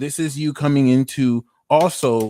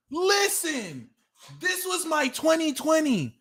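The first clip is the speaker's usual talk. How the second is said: excited; 13 semitones higher, slower than usual. Real, not acted. Excited address.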